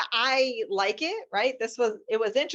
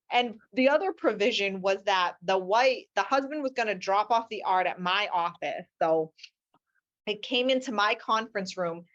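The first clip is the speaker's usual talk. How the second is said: ordinary talk, nothing marked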